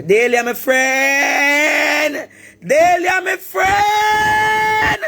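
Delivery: high wailing cry, stretched slow; crying shout. Real, not acted. Calling out to Daily Harris, a wailing crying shout. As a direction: plead at the top of her voice, voice breaking.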